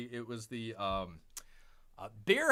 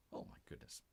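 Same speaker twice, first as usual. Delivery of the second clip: whisper; 20 dB quieter, faster than usual. Real, not acted. Aside after being shushed.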